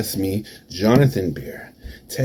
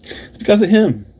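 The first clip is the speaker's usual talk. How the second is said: loud, high outburst; angry accusation; 13 semitones higher, 6 dB louder than usual. Acted. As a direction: throw the blame out hard and high. Accusing his rival in promo.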